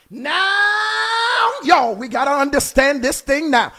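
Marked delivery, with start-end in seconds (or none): 0.1-1.5 NOW held long, climbing high; long rising holler. Real, not acted. Preacher rousing the congregation, long rising holler. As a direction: stretch the word into a high preacher's cry. Preacher peaks on one word.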